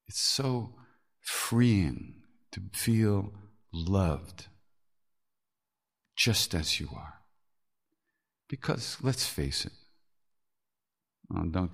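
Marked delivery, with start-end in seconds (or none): 0.1-7.2 tender. Real, not acted. Tender reflection.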